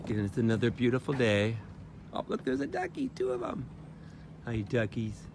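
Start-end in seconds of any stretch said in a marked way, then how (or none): 2.1-3.7 very high, soft delighted cooing. Real, not acted. Woman spotting ducks coos in a very high soft voice. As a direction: squeal softly at the ducks.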